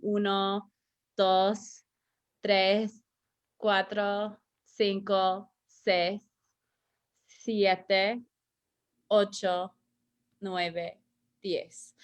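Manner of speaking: careful teacher model of each Spanish number. Shown as a demonstration. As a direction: say each number slowly for the class to repeat. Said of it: "You can repeat them at home".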